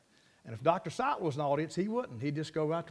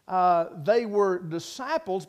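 "they were disciples" slow and louder, each word weighed; stress on disciples; 6 dB louder, slower than usual. Real, not acted. Preacher driving home "they were disciples" to the congregation, slow and emphatic. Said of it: "Now notice that".

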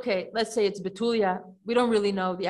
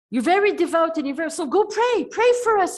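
much higher and louder, fast, desperate begging; pleading elders; 6 semitones higher, 5 dB louder than usual. Retold or imitated. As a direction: plead with her to pray for them. Elders beg Yehudit to pray.

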